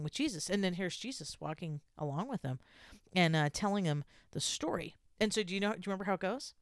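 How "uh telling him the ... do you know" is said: ordinary talk, nothing marked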